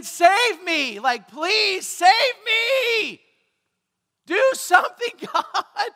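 loud, high, drawn-out cry for rescue; desperate plea. Retold or imitated. Preacher re-enacts his prayer to God, desperate shouted plea. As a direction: beg at the top of your voice.

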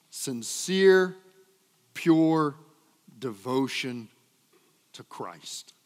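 each word set apart, fading to a hush; words spaced out with pauses, last falling away; stressed slowly. Shown as a demonstration. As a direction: lean on every word, then let it drop. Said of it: "lean in on this".

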